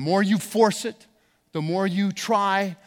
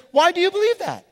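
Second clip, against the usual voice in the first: mock challenger; 12 semitones higher, 6 dB louder than usual. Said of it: "somebody says".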